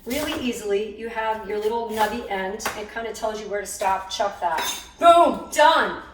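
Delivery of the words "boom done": louder and higher, a punchy boom; triumphant. Real, not acted. Showing viewers the diced onion, loud triumphant boom. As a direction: land it like a mic drop.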